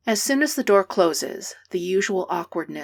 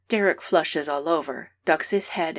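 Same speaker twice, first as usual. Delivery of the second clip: plain reading voice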